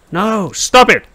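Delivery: high startled cry; shocked yelp. Real, not acted. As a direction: yelp in alarm. Bird vomiting on player.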